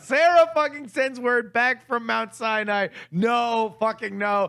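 high, loud, incredulous outburst; retold fury mounting over the runaround; exasperated rant. Customer fed up with being sent to Sarah, exasperated rant.

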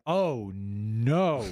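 low, drawn-out dread; slow, hammed-up horror; mock dismay. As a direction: play up grave dismay, pitched low. Acted dismay at the C word.

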